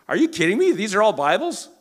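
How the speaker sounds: high, loud disbelief; shocked at the Bibles floor to ceiling; astonished. Retold or imitated. Retold amazed visitor.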